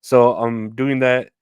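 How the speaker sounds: ordinary talk, nothing marked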